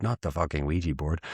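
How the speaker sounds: usual speaking voice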